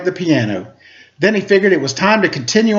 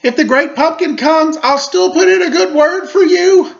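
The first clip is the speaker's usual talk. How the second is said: high, raised voice calling out; calling after; 12 semitones higher, 4 dB louder than usual. A narrator's reading. Linus calling to Sally as she leaves, high raised call. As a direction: call it after someone walking away. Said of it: "Linus called after her".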